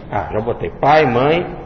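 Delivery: ordinary talk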